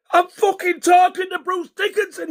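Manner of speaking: very high, squealed disbelief; starstruck outburst. Retold or imitated. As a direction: blurt it out sky-high, amazed.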